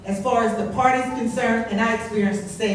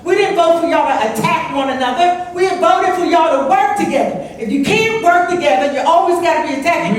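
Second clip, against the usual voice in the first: higher, louder, indignant tirade; angry scolding; 5 semitones higher, 4 dB louder than usual. Real, not acted. Resident at a council meeting berating members, angrily. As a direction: berate them like a fed-up voter.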